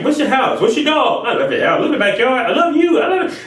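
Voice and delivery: high, gushing fake-friendly voice; high, syrupy flatterer voice; mock nosy flatterer. Voicing a nosy manipulator.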